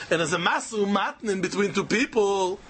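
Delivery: ordinary delivery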